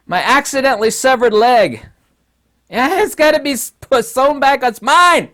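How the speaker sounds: high, loud, hammed-up possessive whine; cling to it like it's yours; mock possessive Russia. Russia claiming Ukraine as its severed leg, hammed-up and loud. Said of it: "I know that's kind of funny".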